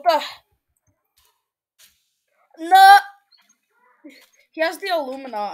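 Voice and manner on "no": loud, raised cry of protest; dismayed shout. Real, not acted. Losing in game.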